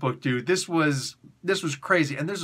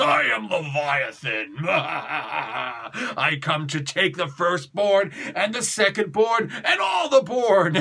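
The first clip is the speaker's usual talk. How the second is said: slow, booming monster voice building to a shout; mock demon voice; slower than usual. Reviewer playing a hellish villain, slow and swelling to a shout. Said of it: "came from the depths of hell talking about".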